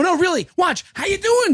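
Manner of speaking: high-pitched, overeager hello; demonstrative greeting. Retold or imitated. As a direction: repeat the greeting high and showy. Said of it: "Just to do it again".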